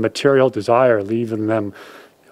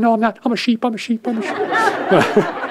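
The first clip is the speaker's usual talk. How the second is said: timid sheep cub; 11 semitones higher than usual. A narrator's reading.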